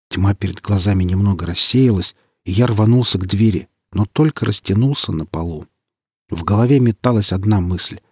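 plain reading voice

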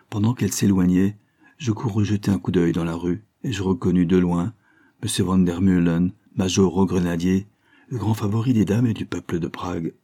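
usual speaking voice

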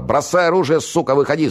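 high-pitched frantic yell; wild, high shouted command. A narrator's reading. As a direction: scream a demand to surrender. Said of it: "заорал".

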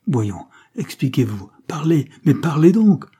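insistent, rising to an exclamation; sharp urging. A narrator's reading. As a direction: press hard for an answer. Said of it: "reprit vivement José".

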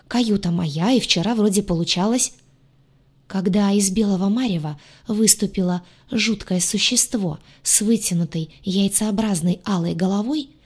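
ordinary delivery

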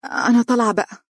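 high, awkward getaway line; flustered. A narrator's reading. Girl excusing herself to leave the flat, flustered. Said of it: "ثم قالت بارتباك".

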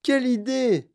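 high, loud burst of disbelief; indignant exclamation. A narrator's reading. Wonka dismisses Charlie's idea, astonished exclamation. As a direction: cry out at the absurd notion. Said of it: "s'écria monsieur Wonka".

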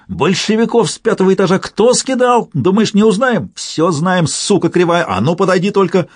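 high-pitched, worked-up rant; piercing, jittery tirade; frenzied accusation. A narrator's reading. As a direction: accuse and dare him in a high voice. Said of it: "приговаривал таракан, дёргаясь".

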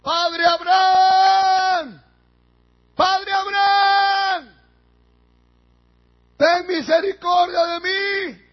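long drawn-out, high-pitched shout; loud, stretched plea at full voice; crying out. Acted. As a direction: call out desperately for mercy from far off. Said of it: "Entonces él dando voces dijo".

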